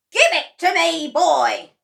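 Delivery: high, very loud demand; Grandma yelling. A narrator's reading. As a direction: roar at the boy. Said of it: "yelled Grandma".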